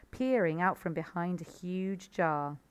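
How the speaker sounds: ordinary delivery, nothing marked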